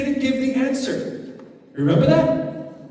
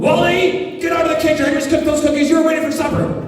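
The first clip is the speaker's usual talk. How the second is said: scolding mom; 6 semitones higher, 4 dB louder, faster than usual. Shown as a demonstration. Mom scolding child in story.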